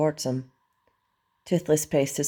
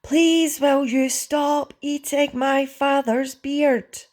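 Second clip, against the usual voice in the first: high, loud, slow strained yell; yelling full out; 13 semitones higher, 5 dB louder, much slower than usual. A narrator's reading. Hiccup tries commanding Toothless, high full-volume yell. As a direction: holler each word high and drawn out. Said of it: "Hiccup yelled as loud as he could".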